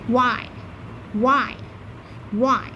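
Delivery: "ওয়াই" said as one glide, falling; teacher repeats "ওয়াই" for the class; letter Y drilled. Shown as a demonstration. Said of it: "ওয়াইর মতো শুনতে লাগবে".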